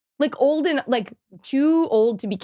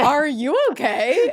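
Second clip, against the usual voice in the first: concerned quote; 7 semitones higher, 5 dB louder, slower than usual. Retold or imitated. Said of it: "It was just like".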